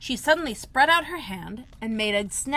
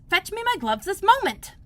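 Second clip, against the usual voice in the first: high, louder impatient order; Rabbit's voice; 11 semitones higher, 6 dB louder than usual. A narrator's reading. Rabbit demanding his gloves, high impatient order. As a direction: bark an order at the maid.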